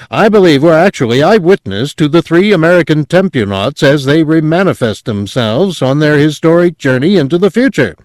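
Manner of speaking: high, loud excited on-air commentary; growing excitement. A narrator's reading. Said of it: "in a voice of growing excitement".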